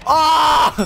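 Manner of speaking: high loud scream; real startled yell. Real, not acted. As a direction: let out a sudden cry. Sudden in-game moment.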